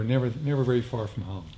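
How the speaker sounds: normal talking voice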